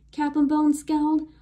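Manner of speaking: ordinary delivery, nothing marked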